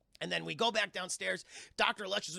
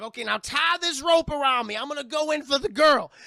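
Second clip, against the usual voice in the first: loud, high-pitched imitated medium giving orders; commanding medium; 7 semitones higher, 12 dB louder than usual. Medium gives orders in retold scene.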